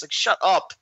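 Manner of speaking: normal talking voice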